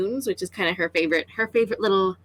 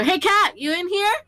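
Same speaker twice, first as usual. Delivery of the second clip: high, raised call; yelling over her shoulder; 10 semitones higher, 4 dB louder than usual. Acted. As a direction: call out to someone across the hangar. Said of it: "yells over her shoulder".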